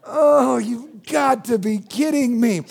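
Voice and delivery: slow, exasperated groan of a reply; acted exasperation. Father answers his pirate-playing daughter, acted exasperation.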